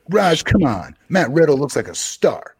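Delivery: high-pitched put-on voice mimicking a commenter; stock impersonation voice. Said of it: "the same voice that I use for everybody's impersonation".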